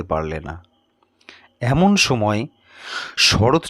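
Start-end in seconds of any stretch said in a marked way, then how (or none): none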